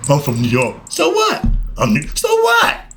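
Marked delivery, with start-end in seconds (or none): 0.9-1.5 high-pitched mocking "so what"; unimpressed high-voiced comeback; dismissive retort. Retorts to a bragging New Yorker. 2.1-2.8 high-pitched mocking "so what"; unimpressed high-voiced comeback; dismissive retort. Retorts to a bragging New Yorker.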